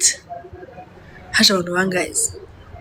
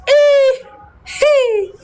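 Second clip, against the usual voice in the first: called up to dance, very high excited shriek. Retold or imitated. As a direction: squeal at being picked. Imagining being called on stage.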